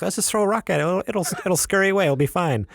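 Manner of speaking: light, high-pitched retold reassurance; breezy dismissal. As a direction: wave the danger off in a high voice. Acts out own casual first reaction.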